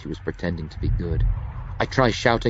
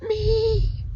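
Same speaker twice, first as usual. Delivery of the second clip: pleading. Acted. Dying Junie pleads.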